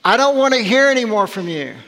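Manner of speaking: Jesus scolding a demon, raising the voice; stern rebuke. Acted. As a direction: order the demon to shut up. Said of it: "Jesus said".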